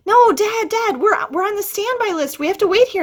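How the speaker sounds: high, urgent pleading with Dad; pleading teen. Retold or imitated. Teen pleads with her dad to wait on standby, high and urgent. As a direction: beg him to stay put.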